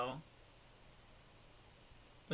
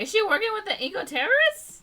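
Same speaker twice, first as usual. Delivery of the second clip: much higher, louder shocked question; higher, louder astonished; 8 dB louder than usual. Real, not acted. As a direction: gasp it out in disbelief.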